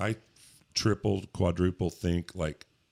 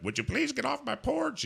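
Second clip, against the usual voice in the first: much higher, prissy neighbor voice; cranky neighbor voice. Retold or imitated. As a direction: scold a stranger off the porch. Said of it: "you can talk to 'em, you know, like".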